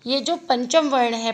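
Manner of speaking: ordinary talk, nothing marked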